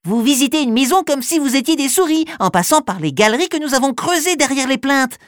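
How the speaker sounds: high, fast playful mouse; mischievous little mouse voice. Acted. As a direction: tease it like a sly little mouse. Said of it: "de sa petite voix espiègle".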